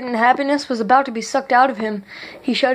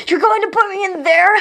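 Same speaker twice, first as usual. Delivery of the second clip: much higher terrified plea; horrified scream; 9 semitones higher, 3 dB louder than usual. A narrator's reading. King seeing the freezing chamber, screaming in horror. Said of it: "screamed in horror".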